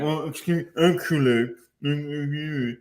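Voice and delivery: lightly voiced pedantic objector, pitched a little up; mock know-it-all fan. Host imagining [name] fans writing in, mock pedantry. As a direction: put on an indignant correcting fan. Mocking an aficionado correcting them.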